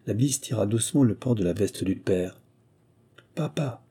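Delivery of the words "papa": half-voiced. A narrator's reading. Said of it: "dit-il à mi-voix".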